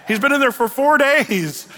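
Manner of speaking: hammed-up protest, pushed up in pitch; mock protest. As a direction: imitate an alarmed objection. Martha protesting at the tomb.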